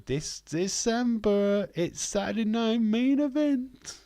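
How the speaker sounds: big booming ring announcer call, stretched out slow; high, drawn-out announcer hype. Pretend. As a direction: sell it like a wrestling announcer building hype. Hyping the upcoming show title.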